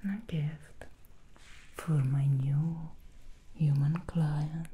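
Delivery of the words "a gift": ordinary talk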